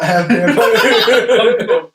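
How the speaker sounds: laughing. Real, not acted. Laughs through vampire robot idea.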